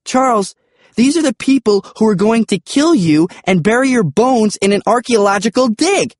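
high-pitched, incredulous scolding; exasperated, high and urgent; stupefied protest. A narrator's reading.

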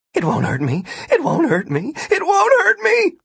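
repeated, climbing in pitch each time; frantic self-reassurance; panicked mantra. A narrator's reading. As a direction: start tense and rise to a high cry. Martin reassures himself while plummeting.

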